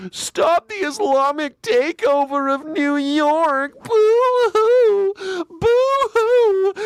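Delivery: high wailing mock sob, boo-hoo; shrill blubbering parody of a whiny right-winger; mocking crybaby. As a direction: whine like a crybaby snowflake, fake crying. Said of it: "They are the biggest crybaby snowflakes".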